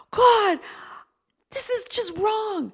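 high, slow cry to God; desperate prayerful cry; anguished plea. Retold or imitated. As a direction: plead in anguish. Crying out to the Lord.

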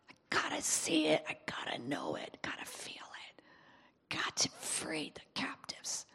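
intense, hushed whisper, barely voiced; whispered prayer. Believer praying in the secret place, intense whisper. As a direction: whisper it urgently, as if praying alone.

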